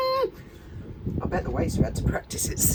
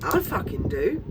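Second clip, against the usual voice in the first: sarcastic; 9 semitones higher, 6 dB louder, slower than usual. Real, not acted. Sarcastic aside to viewers.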